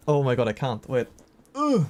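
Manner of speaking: ordinary talk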